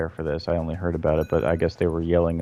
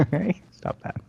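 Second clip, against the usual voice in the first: much higher playful scold; mock game character; 11 semitones higher, slower than usual. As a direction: echo the parody back, lighter. Echoing the parody.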